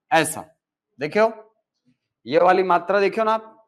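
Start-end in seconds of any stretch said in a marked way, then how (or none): none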